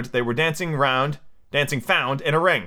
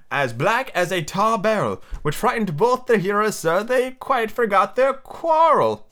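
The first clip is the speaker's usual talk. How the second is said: high, singsong verse reading; theatrical rhyme with stretched words; nursery rhyme recitation; 7 semitones higher than usual. Acted. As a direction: recite the old rhyme high, slowing towards the end. Recites the old song's verse.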